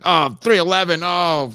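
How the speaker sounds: mock excited fan; mock fan gush. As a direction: gush over the band, high. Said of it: "I'm like".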